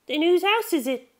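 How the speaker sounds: put-on high curious girl voice; Chiho, high girl voice. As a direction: pitch way up like a curious schoolgirl. Narrator voices Chiho's question.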